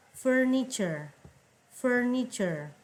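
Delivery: "Furniture" drawn out slowly as /fernit͡ʃər/; teacher slowly spells out "furniture" by sound; slow model pronunciation. Shown as a demonstration. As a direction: stretch "Furniture" syllable by syllable for learners. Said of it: "pay attention to how I pronounce every word".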